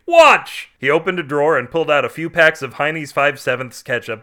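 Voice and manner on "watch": very high, loud yell; one high, loud burst; screamed command. A narrator's reading. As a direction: demand attention with a sharp scream. Screaming Carl demands attention.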